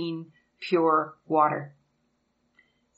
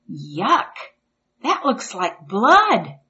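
put-on disgusted little boy; 3 semitones higher, slower than usual.

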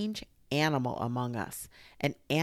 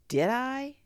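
careless; 11 semitones higher, 7 dB louder than usual. A narrator's reading. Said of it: "said Rabbit carelessly".

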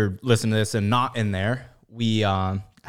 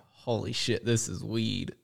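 hushed, softer amazed murmur; awed stoner; 9 dB quieter, slower than usual. Retold or imitated. Imagining the first person to smoke weed, hushed awe. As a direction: marvel like someone discovering weed. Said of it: "Did he smoke weed and be like".

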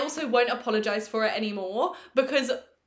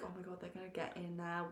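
much softer, fast and hushed; hushed panic; 3 semitones lower, 16 dB quieter, faster than usual. Retold or imitated. Hiding in the toilet from the girls, hushed and fast. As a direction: rush it under your breath, scared. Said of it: "We're like".

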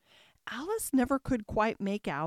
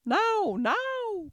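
high, loud urgent cry; 10 semitones higher, 5 dB louder than usual. A narrator's reading. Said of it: "cried the queen".

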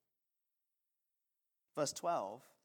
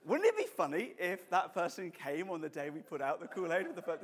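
usual speaking voice

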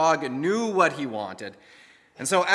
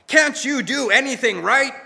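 higher, louder scolding yell; angry shout; 7 semitones higher, 4 dB louder than usual. A narrator's reading. Owner shouting angrily at his dog at the door. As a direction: berate the dog, fed up. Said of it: "an angry man started shouting at the dog".

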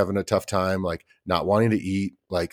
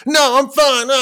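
put-on high, loud little-kid voice, insisting; a meltdown child, squeaky and loud; upset toddler voice; 8 dB louder than usual. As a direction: play a flustered toddler saying she's fine. Toddler voiced while losing it.

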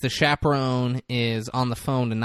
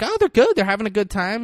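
breezy fib; 9 semitones higher, 5 dB louder, faster than usual. Retold or imitated. Aunt's breezy reply on phone.

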